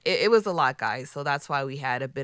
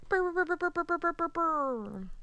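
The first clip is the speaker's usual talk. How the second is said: air horn imitation; 12 semitones higher, 4 dB quieter than usual. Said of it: "Did I sound like air horns?".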